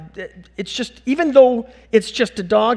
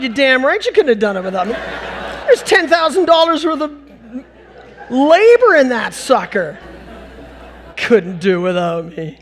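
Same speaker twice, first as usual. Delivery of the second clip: higher, louder, hammed-up indignation; loud comic retort; 5 semitones higher, 6 dB louder than usual. Dad answering his son's thanks, loud comic retort. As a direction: belt out a mock-indignant comeback.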